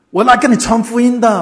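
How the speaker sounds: loud, high mock-tearful outburst; tearful declaration. Crying cobbler explaining his visit, imitated tearful outburst. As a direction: blurt it out as if through tears. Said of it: "哭哭咽咽地说出他的原因".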